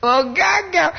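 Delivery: very high, exaggerated let-down cry; hammed-up high disappointed exclamation; full of disappointment. Shown as a demonstration. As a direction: wail it in mock disappointment. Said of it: "Penuh kekecewaan itu".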